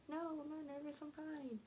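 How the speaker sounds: high-pitched mock insistence; defensive retold denial. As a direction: raise the pitch sharply to deny nerves. Retold denial of being nervous.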